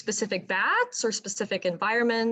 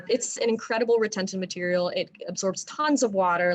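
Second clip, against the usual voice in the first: usual speaking voice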